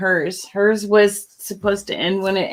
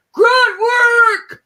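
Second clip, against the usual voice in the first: mock shout; 13 semitones higher, 6 dB louder than usual. Shouting the prize name.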